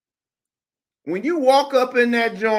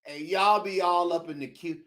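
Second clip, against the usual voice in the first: ordinary talk, nothing marked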